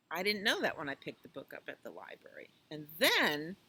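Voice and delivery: ordinary delivery, nothing marked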